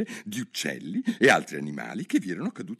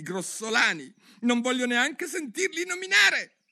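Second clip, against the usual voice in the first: indignant mouse; 10 semitones higher than usual. Acted. The mouse rants to Alice about cats, shrill anger. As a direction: spit out his hatred of cats.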